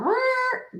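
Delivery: couch creak sound. Retold or imitated. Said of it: "he hears the couch going".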